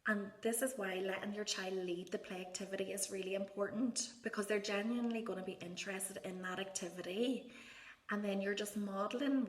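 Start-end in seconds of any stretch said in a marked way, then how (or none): none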